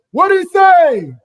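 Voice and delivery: high shouted "What did he say?"; call and response shout. Retold or imitated. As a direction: call it out to a party crowd. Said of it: "you're gonna hear somebody say".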